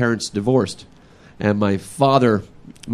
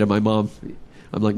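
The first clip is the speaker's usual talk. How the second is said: ordinary talk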